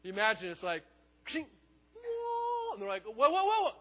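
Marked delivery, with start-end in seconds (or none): 1.2-2.7 vanishing sound effect. Pretend. Imagined sound of Jesus vanishing. 3.0-3.7 much higher and louder shocked whoa; stunned whoa. Acted. Disciples stunned as Jesus vanishes.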